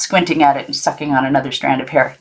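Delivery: ordinary delivery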